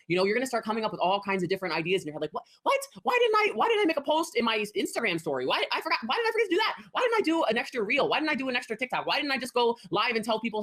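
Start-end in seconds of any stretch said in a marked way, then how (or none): 2.3-10.6 much higher, rapid-fire alarmed questions; sudden dawning panic over missed tasks; panicked realizations. Retold or imitated. Coach acting out a seller's sudden realizations, high and alarmed.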